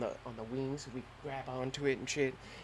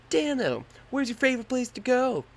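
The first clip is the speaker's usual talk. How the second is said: high, louder eager question; imitating himself; 11 semitones higher, 8 dB louder than usual. Jumper asking the instructor, in a high eager voice. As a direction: ask it pitched up and eager. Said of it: "So I was like".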